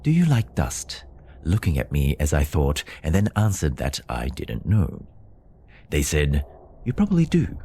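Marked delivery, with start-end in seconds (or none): 0.0-1.0 put-on high-pitched little child figure; light, high voice of a moon child; child's voice. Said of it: "The child stood and said". 6.8-7.6 put-on high-pitched little child figure; light, high voice of a moon child; child's voice. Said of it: "The child stood and said".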